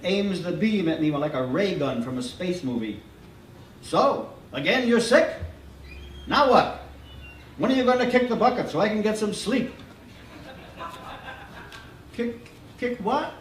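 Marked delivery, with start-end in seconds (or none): 3.8-9.7 high, loud, teasing doctor voice; grumpy doctor. A narrator's reading. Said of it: "talks fast and funny". 12.2-13.3 put-on high, puzzled child voice; a small sick kid not getting it; confused little boy. A narrator's reading. Boy voiced in read story.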